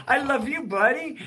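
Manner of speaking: put-on high, cooing reply to a toddler; tender reply. Grandpa answering his grandson, high and cooing. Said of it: "And I go".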